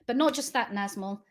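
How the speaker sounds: ordinary delivery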